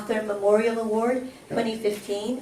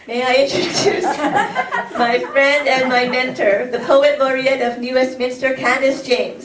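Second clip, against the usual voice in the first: loud, laughing big announcement; excited introduction; 3 semitones higher, 9 dB louder than usual. Real, not acted. Host introduces her friend and mentor, loud and laughing. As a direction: build it up and bring her on with a laugh.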